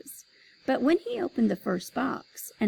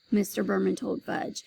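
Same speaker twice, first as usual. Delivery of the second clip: ordinary delivery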